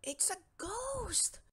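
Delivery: high, slow gasp of fright; frightened cry. Acted. Scared cat spots a ghost, slow high fright. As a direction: draw out the fear.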